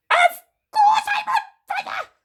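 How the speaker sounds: high, loud outraged shriek; yelling. A narrator's reading. Grandma answering George's doubt, indignant high yell. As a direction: shriek it back at him, indignant. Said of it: "she yelled".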